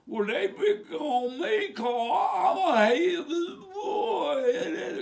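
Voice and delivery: high, slow hammed-up wail; mock crying. Picked-on hillbilly complaining, mock crying. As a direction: blubber in badly faked self-pity. Acts out a self-pitying angry complaint.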